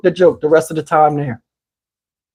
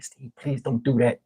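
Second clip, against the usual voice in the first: low, soft retold pleading; hushed plea; 6 semitones lower, 12 dB quieter than usual. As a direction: plead under your breath, like a wife at table. Wife privately pleading with him.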